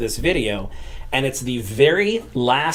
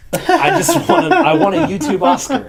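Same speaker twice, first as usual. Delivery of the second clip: laughing; 12 semitones higher, 5 dB louder than usual. Real, not acted. Laughing joke about Oscar.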